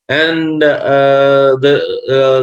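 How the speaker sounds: usual speaking voice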